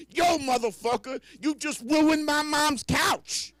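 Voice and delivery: put-on high, loud, slow kid yell. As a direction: scream it like an angry kid. Kids yelling after him.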